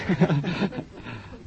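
amused laugh at his own anecdote; real laugh. Real, not acted. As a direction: laugh after landing the punchline.

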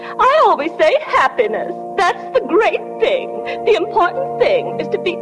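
sentimental chatter with swooping pitch; gushing. Acted. Sentimental guest chattering at the wedding, gushing. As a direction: gush on, lingering fondly on key words.

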